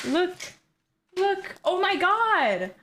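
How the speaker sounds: usual speaking voice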